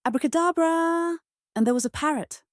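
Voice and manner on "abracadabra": shouted spell. A narrator's reading. Winnie waves her magic wand to make a friend, shouted spell. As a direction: cry out the spell.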